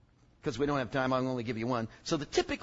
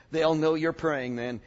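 ordinary delivery

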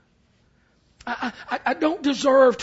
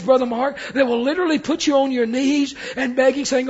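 ordinary talk

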